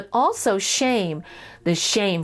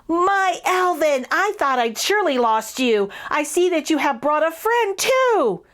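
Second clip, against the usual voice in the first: put-on joyful boy; 6 semitones higher than usual. A narrator's reading. Connor greeting his lost mouse, high and joyful. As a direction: welcome him home.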